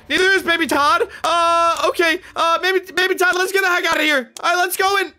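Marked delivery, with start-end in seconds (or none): 1.2-5.1 high, jittery pitch; nervous urgency. Acted. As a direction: hurry the kid out, spooked. Dad spooked by flickering lights.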